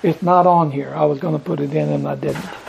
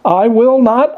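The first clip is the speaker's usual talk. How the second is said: "I will not" lifted higher and louder; stronger second take; 4 semitones higher, 4 dB louder than usual. Acted. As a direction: press "not" harder. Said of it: "he said it three times".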